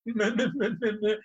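high mock blubbering "meh, meh"; imitating weepy crying. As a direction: ham up a weepy girl sobbing through a smile. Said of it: "She's all weepy".

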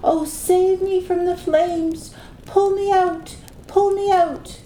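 high, pleading cry for help from the fire; desperate cry. A narrator's reading. Trapped woman calling from the bonfire, high desperate plea. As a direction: beg to be pulled from the flames. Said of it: "a woman's voice crying".

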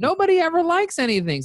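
high-pitched excited exclaim; amazed disbelief. Retold or imitated. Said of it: "I was like".

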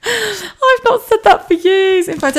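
high, laughing, giggling through it; giggly glee. Real, not acted. Telling listeners about her good night, high and giggling. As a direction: giggle as the cheeky word slips out. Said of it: "I'm in a really good mood".

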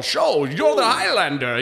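lightly done Scottish brogue, slow; a hint of Connery's Scottish drawl; Sean Connery Scottish accent. Scottish accent, retelling Sean Connery in Highlander. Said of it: "Scottish accent".

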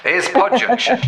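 light mock platform announcer; train station announcement. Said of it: "sounds like I'm at a train station".

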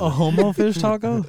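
mock disbelief. Mocking disbelief at a friend's phrase.

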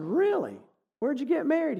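high-pitched retold surprise; imitated astonished question; surprised. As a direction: react to unexpected news, voice jumping up. Retells his surprise at wedding news.

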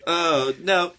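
normal talking voice

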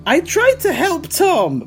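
high, slow put-on guide voice; Manuel's voice. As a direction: speak as the helpful local guide.

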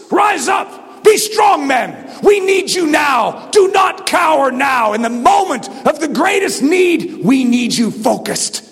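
high, shouted rallying challenge; war cry. Acted. Commander challenging the troops to rise, war cry. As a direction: call weary troops up to fight. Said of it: "That's a challenge unto the troops".

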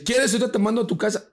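high, loud imitation of the sister; insistent sister. Sister offers to send girlfriend home, insistent.

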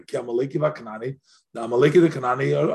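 ordinary talk

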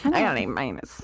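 put-on low sulky teen grumble; grumpy eighth grade voice. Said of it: "in my grumpy eighth grade voice".

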